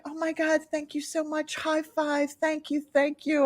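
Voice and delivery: soft, high gushing thanks; grateful gushing. Retold or imitated. Thanking the child who got her keys, grateful. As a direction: gush gratefully in a small high voice.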